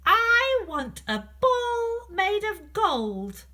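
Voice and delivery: high, slow, demanding princess voice; spoilt princess. Acted. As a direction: whine it out like a spoilt little princess. Princess demands a gold ball.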